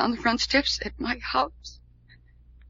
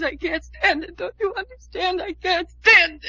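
anguished pleading; 6 semitones higher than usual. Acted. Breaking down.

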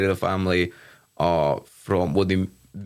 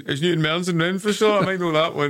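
pretend local expert; 8 semitones higher than usual. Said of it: "I was thinking".

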